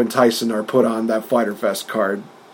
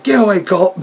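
mock snap; 7 semitones higher, 3 dB louder than usual. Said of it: "He's like".

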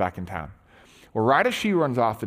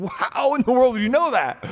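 high, loud astonished gasp of a question; a mind just blown, asking how; stunned disbelief; 10 semitones higher, 4 dB louder than usual. Retold or imitated. Samaritan woman amazed at Jesus, high astonished question.

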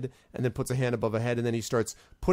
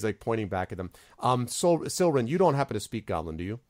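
normal talking voice

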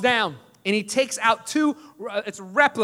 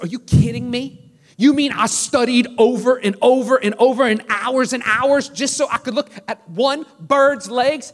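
higher-pitched, indignant retold rant; furious student; 4 semitones higher, 3 dB louder than usual. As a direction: act out an outraged student, incredulous and emphatic.

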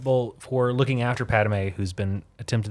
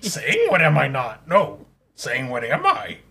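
put-on sage voice, laughing and muddled; Yoda; 3 semitones higher than usual. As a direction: get lost in backwards grammar, half laughing. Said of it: "It's a good Yoda".

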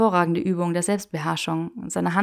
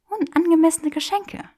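put-on higher, playful young woman; teasing Hermione voice; 10 semitones higher, 5 dB louder than usual. Granger teasing Draco in the bookshop, playful and higher. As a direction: tease him with mock refusal.

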